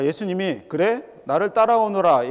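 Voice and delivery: ordinary talk, nothing marked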